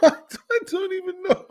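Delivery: words squeezed out through a high laugh that is close to crying; despairing laugh. Real, not acted. Fan laughing in despair at his team's play, close to tears. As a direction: laugh helplessly as you say it.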